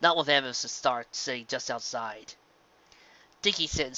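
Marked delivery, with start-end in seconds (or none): none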